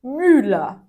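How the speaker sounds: Müller with rounded lips, ü between i and u; German ü in Müller. Shown as a demonstration. Teacher showing students the umlaut in Müller. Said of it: "Por exemplo".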